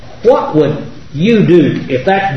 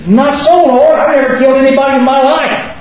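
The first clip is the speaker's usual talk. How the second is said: high-pitched, earnest objection to God; pleading protest; 8 semitones higher than usual. Acted. Own protest in imagined scene.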